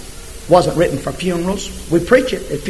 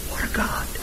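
breathy hushed awe; hushed reverent aside; awed whisper; 12 dB quieter than usual. Real, not acted. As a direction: whisper it in wonder. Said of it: "awed whisper aside".

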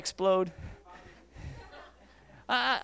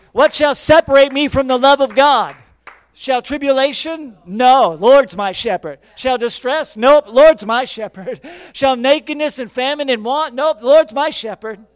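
loud, slow defiant declaration; shouted preaching; 4 semitones higher, 9 dB louder than usual. Acted. Pastor quoting Paul to the church, shouted preaching. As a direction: proclaim it like a battle cry. Preaching Paul's words loudly.

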